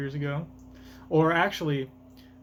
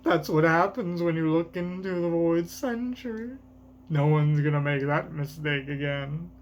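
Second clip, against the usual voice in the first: lightly acted comic-book villain dialogue; lightly voiced manga character; 3 semitones higher than usual.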